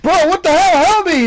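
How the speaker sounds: imitating a frantic, high-pitched shout; panicked yell for help. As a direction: yell it high and loud like a desperate teammate. Acting out a downed player's plea.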